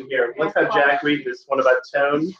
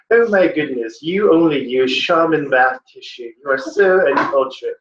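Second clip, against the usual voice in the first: condescending fake British. Teacher showing what condescending means, uppity fake British accent. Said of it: "use my fake British accent to sound condescending".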